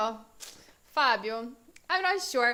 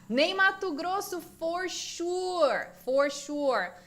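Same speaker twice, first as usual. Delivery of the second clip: higher-pitched, keen approval; enthusiastic agreement; 6 semitones higher, slower than usual. Real, not acted. Teacher welcoming a student's music pick, enthusiastic. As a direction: jump on the suggestion, pitched up. Reacting keenly to a viewer's suggestion.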